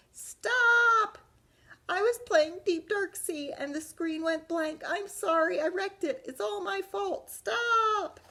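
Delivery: high, loud desperate yell. Acted. Arthur yelling to stop Mom pressing the button, very high and loud. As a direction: scream it to stop her in time. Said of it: "Arthur yelled".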